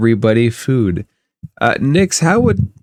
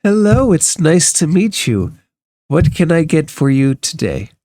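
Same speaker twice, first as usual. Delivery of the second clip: lightly voiced innkeeper, higher at the greeting; welcoming innkeeper; 6 semitones higher than usual. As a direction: greet a customer in a slightly lifted voice. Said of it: "Elsa steps up to you and she says".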